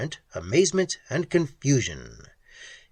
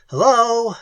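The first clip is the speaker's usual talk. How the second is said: loud, much higher shout; yell; 9 semitones higher, 8 dB louder than usual. Acted. Ham yelling hello at the creature, loud. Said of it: "He leaned forward and yelled".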